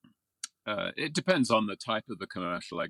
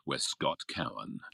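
movie trailer voice; 5 semitones lower than usual. Retold or imitated. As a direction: intone it like an epic trailer. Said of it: "the guy that did, In a World".

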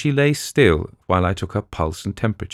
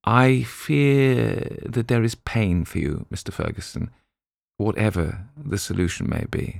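gentle; 5 semitones higher than usual. A narrator's reading. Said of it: "put his hand soothingly".

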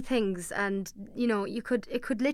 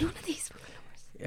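whispered aside; 7 semitones higher, 9 dB quieter than usual. Real, not acted. Muttered aside during game.

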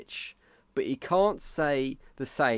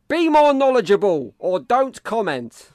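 mock stern; 9 semitones higher, 11 dB louder, slower than usual. Reading a hostile review aloud.

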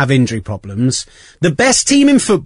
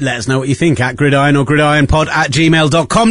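usual speaking voice

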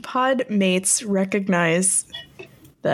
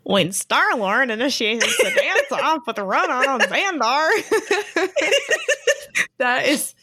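nerdy reread; 4 semitones higher than usual. Pretend. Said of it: "the dorkiest question".

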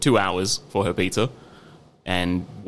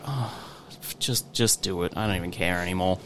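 softer, low imitation of giving up; weak, defeated; 4 semitones lower, 7 dB quieter than usual. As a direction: sigh it out like a beaten boss. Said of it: "I'd never heard him so weak".

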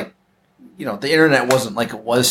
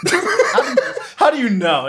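laughing; 12 semitones higher than usual. Real, not acted. Laughing at mistaken beating.

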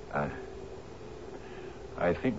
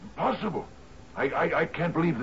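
much higher, stammering in shock; refusing to believe it; shocked disbelief; 12 semitones higher than usual. Acted. Captain hearing the coffins moved, high stammering disbelief.

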